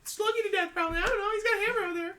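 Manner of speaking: put-on high, frantic young woman's voice; B, panicked roommate. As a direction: play B squeaky and flustered.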